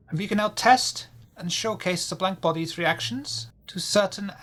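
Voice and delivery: put-on high vague French-German mad scientist accent; villain lecturing over the intercom, accented. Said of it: "It's a little French, a little German".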